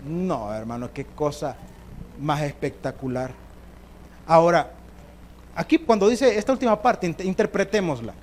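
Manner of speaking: normal talking voice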